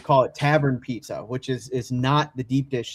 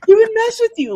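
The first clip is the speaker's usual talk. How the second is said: laughing; 5 dB louder, faster than usual. Real, not acted.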